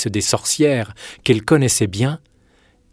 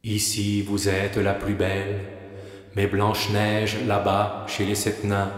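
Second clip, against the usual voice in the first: hushed, level oracle; magic mirror; 2 semitones lower, 6 dB quieter than usual. Acted. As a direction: answer softly as an enchanted mirror, barely varying the pitch.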